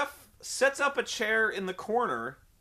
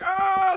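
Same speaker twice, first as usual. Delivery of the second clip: loud high held "aah" of agony; long hammed-up yell of pain; mock pain scream; 13 semitones higher, 8 dB louder than usual. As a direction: imitate a wrestler howling in an armbar. Imitating man being choked.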